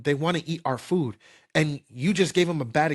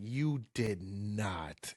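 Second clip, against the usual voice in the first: slow, soft, drawn-out disbelief; stunned disbelief; 5 semitones lower, 11 dB quieter, much slower than usual. Retold or imitated. Friend staring at him after the chili mistake, stunned hushed disbelief. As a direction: let it sink in, barely above a breath. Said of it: "gave me a face like".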